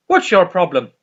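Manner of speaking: Dougal asking. Retold or imitated. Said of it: "And Dougal's like".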